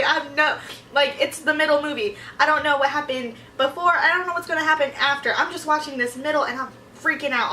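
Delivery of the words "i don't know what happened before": ordinary talk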